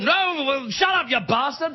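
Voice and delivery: posh British insult. Retold or imitated. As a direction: snap back like a rowdy British MP. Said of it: "they just yell at each other".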